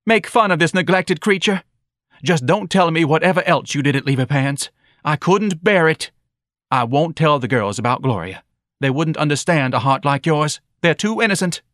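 shouted reproach. A narrator's reading. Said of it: "Missus Levy shouted".

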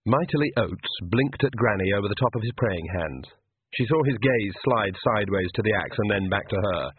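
ordinary delivery, nothing marked

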